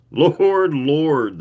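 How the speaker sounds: doctor catching his breath from laughing; gasped through laughter. Acted. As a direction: wheeze it out after a big laugh. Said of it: "the doc gasped".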